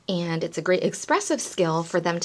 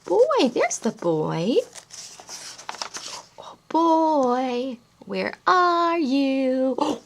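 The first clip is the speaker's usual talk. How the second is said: higher, slow sing-song searching; playful hide-and-seek voice; reading to children; 6 semitones higher, 4 dB louder, much slower than usual. Acted. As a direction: coax the child to look. Reading picture book to toddler.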